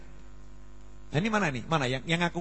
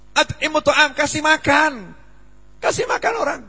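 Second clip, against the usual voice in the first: emphatic preaching; 9 semitones higher, 5 dB louder than usual. Real, not acted.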